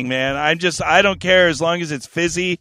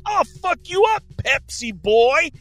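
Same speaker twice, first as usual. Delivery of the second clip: high mock threat, slowing on the last words; 10 semitones higher than usual. Threatening the Pepsi truck driver, hammed-up menace. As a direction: puff up a retold road-rage threat. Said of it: "And so I'm like".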